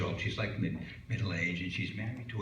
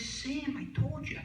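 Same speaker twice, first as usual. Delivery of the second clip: high, scolding wife voice; exasperated wife; 13 semitones higher than usual. Acted. Wife scolding Sam in bed, high-pitched exasperation. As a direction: chide him, voice pitched up. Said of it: "She says".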